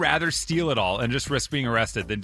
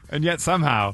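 usual speaking voice

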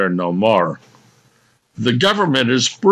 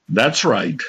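normal talking voice

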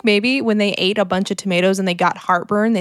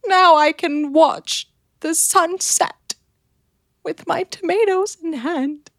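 dreamy swooning voice; 7 semitones higher, slower than usual. Acted. Mock swooning lady.

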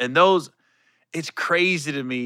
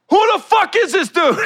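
loud, high, angry shout at a stranger; going off; 5 dB louder than usual. Retold or imitated. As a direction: blow up at the intruder backstage. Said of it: "went ham on the dude".

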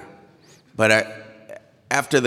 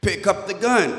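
higher-pitched, firm cop command; insistent order; 10 semitones higher than usual. Retold or imitated. Officer pressing the driver once more, insistent.

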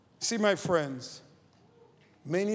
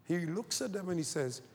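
ordinary talk

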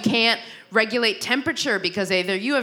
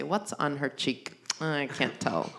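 quiet puzzled mutter; 7 semitones lower, 10 dB quieter, slower than usual. Pretend. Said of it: "look in their rearview mirror and say".

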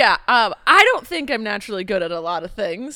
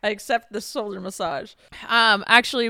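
normal talking voice

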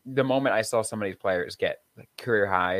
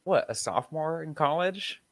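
incredulous; 6 semitones higher than usual. Real, not acted. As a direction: catch yourself realising the years. Realising how long ago.

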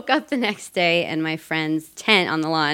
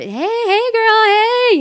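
high, loud mock flirt call; sing-song come-on from a stranger; English guy chatting her up; 5 dB louder, much slower than usual. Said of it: "chatting me up".

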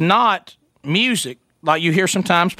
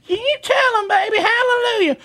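high cheering mimic of the wife; mock wife cheering. Wife egging her husband on during his radio testimony, high and cheering.

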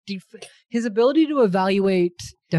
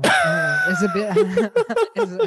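a much higher, laughing squeak; squeaky laughing confusion; 4 dB louder, slower than usual. Real, not acted. Laughing at losing track of time, a high squeaky giggle.

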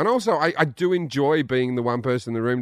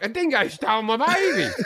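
much higher, hammed-up quoting voice; mocking quote; 8 semitones higher than usual. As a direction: imitate someone gleefully parroting a famous line. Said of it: "And you're just like".

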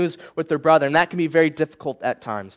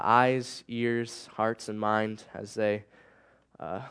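softer, hushed prayer voice; earnest prayer; 5 semitones lower, 6 dB quieter than usual. Acted. Youth pastor opening the sermon with prayer, soft and sincere. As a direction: pray gently and sincerely.

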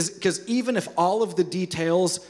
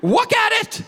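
put-on upset toddler; 12 semitones higher, much slower than usual. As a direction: cry it out like an alarmed two-year-old. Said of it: "he starts saying".

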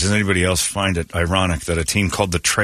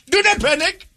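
mock Indian accent. Showing the scariest accent to hear, high mock Indian accent. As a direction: demo the alarming Indian reassurance. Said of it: "scariest accent to hear".

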